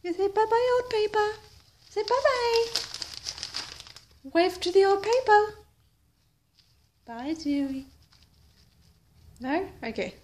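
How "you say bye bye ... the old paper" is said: high sing-song coaxing. Real, not acted. Coaxing the pet to say bye-bye, baby talk.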